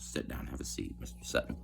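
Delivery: hushed, whispered, grave invitation to sit; soft official voice. Retold or imitated. Internal Affairs man seats the inmate, hushed and grave. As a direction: murmur it like a solemn investigator.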